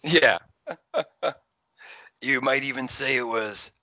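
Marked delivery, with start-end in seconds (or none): 2.2-3.7 dramatic trailer line. Retold or imitated. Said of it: "in a very dramatic, misplaced way".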